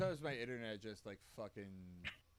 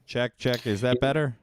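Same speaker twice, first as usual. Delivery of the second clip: raised voice into the mic; louder mic check; 12 dB louder than usual. Real, not acted. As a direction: speak up for the mic. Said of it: "my voice sounds low?".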